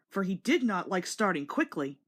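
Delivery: ordinary delivery, nothing marked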